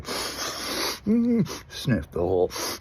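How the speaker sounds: long, noisy mimed sniffs; exaggerated snorting. Comic re-enacts sniffing the whole line, hammed-up snorting. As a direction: act out snorting a huge line.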